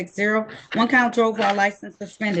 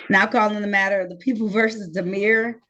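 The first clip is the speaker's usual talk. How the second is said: ordinary talk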